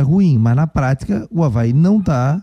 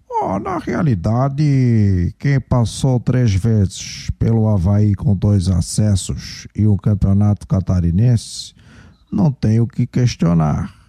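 low, slow imitated president voice; club president impression; 5 semitones lower, slower than usual. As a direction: drop low and drag it out like the president. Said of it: "faça imitando o presidente".

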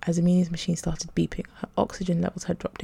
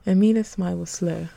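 usual speaking voice

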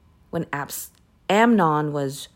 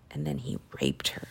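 hushed whisper; 10 dB quieter than usual. Real, not acted. Telling the congregation the awful part in a whisper. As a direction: whisper it, voice dropping away. Grim turn in the story.

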